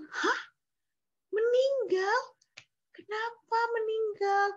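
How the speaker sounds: shocked, pitch jumps up; shocked friends. Acted. Friends hear the boy died, shocked and high.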